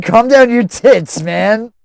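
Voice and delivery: loud and slow, mock exasperated; mock exasperated shout. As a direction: yell it slowly, ribbing him. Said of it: "You're right".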